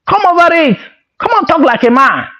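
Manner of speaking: high, sharp mock scolding; angry demand. After slapping him, guy scolds his friend sharply. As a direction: snap at him to talk like a man.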